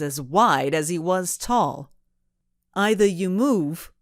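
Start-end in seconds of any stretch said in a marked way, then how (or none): 2.7-3.9 put-on a louder threat in a thick New England accent. A narrator's reading. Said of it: "with a thick New England accent".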